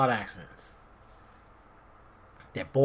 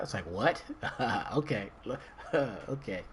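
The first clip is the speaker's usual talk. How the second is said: laughing; 9 dB quieter than usual. Real, not acted. Laughing at his own story.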